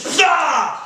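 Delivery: a girlfriend punching her boyfriend at a speed bump, mock yell. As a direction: blurt a fake punch sound.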